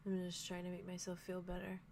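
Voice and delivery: soft, held-down delivery; subdued bitterness. Acted. Kai voices Arlo's lie back, subdued and soft. Acted accusation in sad scene.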